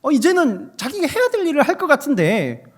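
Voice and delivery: loud, high-pitched pulpit exclamation; forceful preaching. Real, not acted. Preacher tells of a cherished student's duty, forceful preaching. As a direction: press the point home at full volume.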